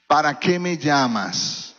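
low, slow, cold rejection from a father; lightly voiced father turning his daughter away; cold father's voice. Padre frío rechazando a su hija, voz baja y lenta. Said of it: "su papá le decía de esta forma".

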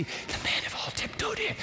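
hushed whisper as if not to disturb. Acted. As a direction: breathe it like the men tiptoeing in. Whispering about men tiptoeing in.

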